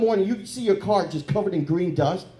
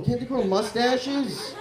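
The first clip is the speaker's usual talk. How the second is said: normal talking voice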